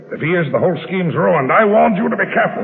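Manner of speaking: rising to a high, loud furious shout; angry scolding. Acted. Boss blames Berger for the dying prisoner, building to an angry shout. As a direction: build from worried to an outright yell.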